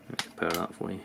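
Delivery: usual speaking voice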